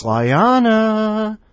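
long, drawn-out, loud and high intro; announcer call. Acted. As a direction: call the name out like a big announcement. Announcing the next entry.

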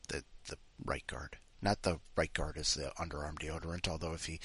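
breathy whisper, under the breath; whispered aside. Real, not acted. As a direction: drop to a whispered joke.

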